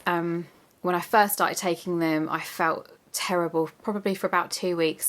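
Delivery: ordinary talk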